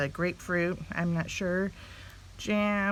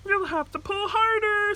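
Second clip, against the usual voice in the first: high, louder kindly voice; funny fellow voice; 14 semitones higher, 5 dB louder than usual. A narrator's reading. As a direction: ask like a funny friendly fellow. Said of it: "said the funny fellow".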